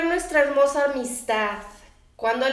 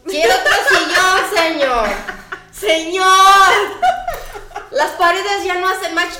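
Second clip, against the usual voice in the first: louder, laughing cry of the real meaning; laughing exclaim; 6 semitones higher, 7 dB louder than usual. Real, not acted. A host telling husbands what wives really want, laughing. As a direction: blurt the punchline through laughter.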